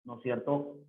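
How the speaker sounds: ordinary delivery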